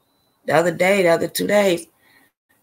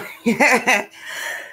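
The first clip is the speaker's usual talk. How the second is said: real laugh; 6 semitones higher than usual. Real, not acted. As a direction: burst of laughter, falling. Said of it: "You got me to laugh".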